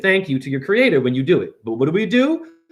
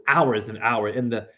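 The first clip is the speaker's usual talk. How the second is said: ordinary talk, nothing marked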